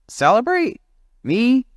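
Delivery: grumpy chimp scoffing at the idea; incredulous grump. Acted. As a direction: spit the words back in disbelief. Jim scoffing.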